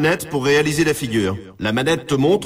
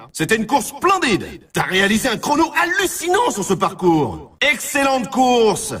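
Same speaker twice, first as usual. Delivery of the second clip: high, excited sports-game cheer; enthusiastic praise; 5 semitones higher than usual. Acted. Game announcer praising player's run, enthusiastic cheer. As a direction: gush over a brilliant run.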